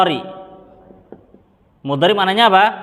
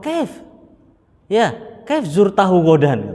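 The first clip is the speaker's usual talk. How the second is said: high startled "Kaif?" then a questioning follow-up; puzzled Libyan; 3 semitones higher, slower than usual. Retold or imitated. The Libyan baffled by the Indonesian's answer, startled. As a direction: jump up in pitch in disbelief. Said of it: "orang Libi itu ngomong".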